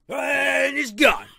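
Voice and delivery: drawn out like a trailer voice; dramatic announcer. Pretend. Friend echoes the plot twist in a big mock announcer voice.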